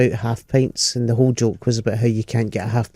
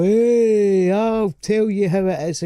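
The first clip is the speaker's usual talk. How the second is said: higher, cocky bloke voice; older bloke; 6 semitones higher, slower than usual. Retold or imitated. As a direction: puff up like a know-it-all man over fifty. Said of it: "all guys over fifty".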